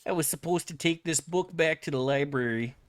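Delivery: hammed-up Midwestern Wisconsin voice; Wisconsin accent. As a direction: put on a Wisconsinite fretting loudly. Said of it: "you're from Wisconsin and you're like".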